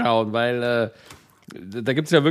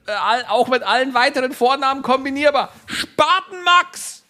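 higher, hammed-up sales pitch; hammed-up shopping channel presenter; teleshopping host; 10 semitones higher, 3 dB louder than usual. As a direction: sell it like a TV shopping host, laughing. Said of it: "Teleshopping-Kanal".

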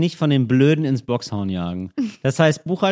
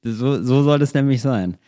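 ordinary delivery

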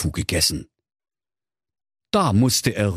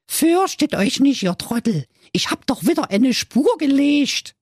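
put-on high cheeky little boy; squeaky know-it-all kid. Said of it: "sprach zu seinen Brüdern". (hohe freche Kinderstimme)

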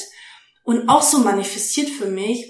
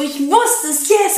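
excited yell; 6 semitones higher, slower than usual. Retold or imitated. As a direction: cheer out the yes at the news. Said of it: "Ich so".

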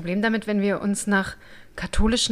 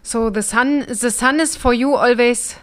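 put-on English with a thick German accent, slow and louder; mock German-accented English, drawn out; 4 semitones higher, 5 dB louder, slower than usual. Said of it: "[name] jetzt noch nie Englisch sprechen gehört".

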